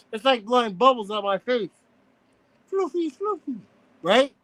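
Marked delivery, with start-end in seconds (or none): 2.7-3.6 lightly puffed pufferfish voice. Shown as a demonstration. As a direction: blow bubbles through puffed cheeks. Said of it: "That's weird. That's a weird way".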